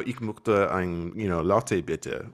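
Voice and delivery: put-on slow, lightly accented German order; plain German attempt. Retelling ordering at a German café, slow with a light accent. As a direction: order a latte in careful German. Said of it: "unless I have an accent".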